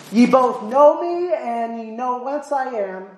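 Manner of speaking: high, drawn-out proclamation; retold shout. Preacher voices Jesus crying out in the temple, retold shout. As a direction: cry it out high and slow, as if across a crowd. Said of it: "he's shouting at a religious celebration".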